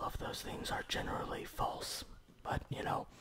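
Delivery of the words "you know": ordinary delivery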